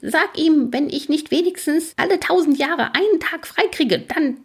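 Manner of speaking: very high, worked-up character voice; griping ferryman. Acted. Ferryman grumbles about refused holidays, high indignant rant. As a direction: rant on indignantly until words run out.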